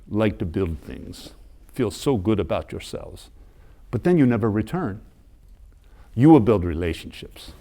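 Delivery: put-on measured priest with a mild Ugandan accent; light Ugandan accent. Ugandan priest takes the American visitor's hand, gently accented admonishment in a Ugandan accent. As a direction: imitate a Ugandan accent lightly, leaving pauses between phrases. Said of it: "I'm gonna do a little Ugandan accent".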